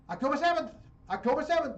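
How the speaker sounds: mocking imitation. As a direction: parrot his talking point, high and loud. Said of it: "and he said".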